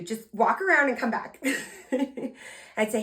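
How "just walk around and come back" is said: ordinary talk, nothing marked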